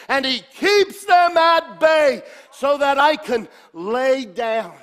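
loud, high, slow proclaiming of the psalm; fervent meditation. Shown as a demonstration. Preacher showing how to meditate on the psalm, fervently. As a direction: preach each word as your own. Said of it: "This is how you meditate".

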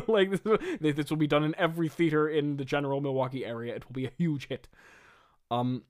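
usual speaking voice

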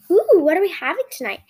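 high, loud, eager question; super interested. A narrator's reading. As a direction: pounce on it with keen curiosity. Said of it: "Kate asks, super interested".

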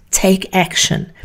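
usual speaking voice

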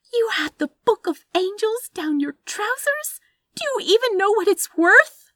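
very high, loud, incredulous outburst; shrieked outrage. A narrator's reading. Anathema learns the priceless book was in his trousers, appalled shriek.

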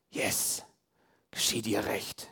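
whispered, secretly gloating; gleeful whisper. Retold or imitated. Preacher confessing the inner thought when a partner gets hurt, gloating whisper. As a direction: hiss it under your breath, pleased with yourself.

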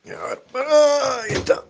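high, slow pet talk. Real, not acted. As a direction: coo at the cat in a high voice. Baby talk to the cat.

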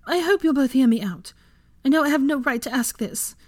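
high-pitched, earnest mother's appeal; pleading. A narrator's reading. Mother gathering courage to ask, pleading. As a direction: plead to be heard out. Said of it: "She gave them a pleading look".

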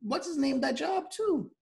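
high, loud excited burst. Real, not acted. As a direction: blurt it out, thrilled. Excited recall of film.

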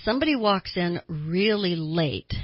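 ordinary delivery

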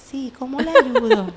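lifted, put-on helpful voice; mock-polite nurse. Nurse answering an agitated patient, put-on polite. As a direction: answer a yelling patient with exaggerated courtesy. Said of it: "me están gritando y yo".

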